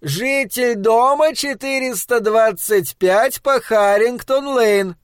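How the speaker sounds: slow, high announcing shout; shouting back. Acted. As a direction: Flanagan shouts back the boy's address. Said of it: "крикнул в ответ".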